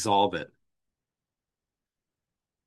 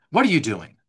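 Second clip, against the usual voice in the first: a louder, scornful demand; contemptuous snap; 3 semitones higher, 6 dB louder, faster than usual. Retold or imitated. Angry parent confronting a child, loud and scornful. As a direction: bark it out with scorn. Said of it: "contempt in the tone".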